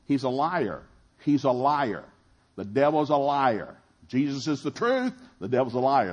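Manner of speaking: loud declaration. Acted. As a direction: proclaim it to the crowd, full voice. Preacher declaring devil a liar.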